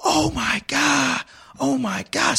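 very high, drawn-out mock scream; imitated screaming. Coach heard from the sideline, imitated screaming. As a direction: wail it out high and slow, not at full volume.

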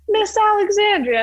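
high, loud coach voice over the radio; imitating coach. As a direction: call her name like the coach on the radio.

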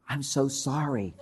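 slow, hushed apology; soft apology. Retold or imitated. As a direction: say sorry softly and slowly. Says the expected apology.

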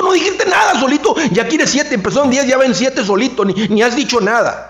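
high-pitched, incredulous insistence; worked-up emphasis. Real, not acted. Speaker marvelling at price dropping unprompted, worked up. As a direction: hammer the point home excitedly.

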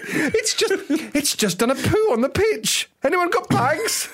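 peer at the dog like a snooty aristocrat; posh voice. Pretend. Mocking posh teammates puzzling over the dog, high plummy voice.

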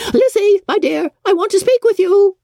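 high, loud call through the door; high mother calling through half-open door. A narrator's reading. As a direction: call her daughter out of the room. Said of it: "called out".

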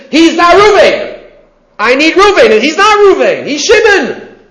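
high, indignant complaint about not finding Reuven; mock frustration. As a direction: play the man fuming at the wrong person. Acting out the frustrated seeker.

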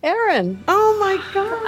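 high, drawn-out squeal of a welcome; overjoyed high cry of her name; excited greeting. Real, not acted. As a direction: greet her like a long-lost friend. Greeting a guest she admires.

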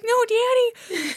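put-on high, drawn-out child voice; pleading little girl. Little girl protesting to her farmer daddy, high mock-child voice.

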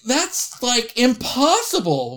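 high, drawn-out incredulous protest; retold disbelief. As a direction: balk at it in amazement.

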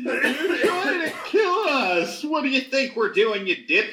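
high, slow, laughing sneer; mocking guard.

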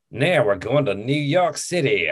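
botched, hammed-up Aussie accent; bad Australian accent. Mimicking an Australian movie character, bad Australian accent. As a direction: attempt an Australian accent and overdo it. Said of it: "I can't do an Australian accent".

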